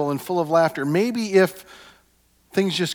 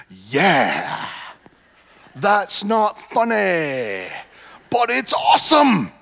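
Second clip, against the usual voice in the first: slow, rising into a high, loud shout on awesome; odd outburst; 6 semitones higher, 5 dB louder, much slower than usual. Real, not acted. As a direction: blurt it out, then burst loud on awesome. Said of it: "I don't know what just happened there".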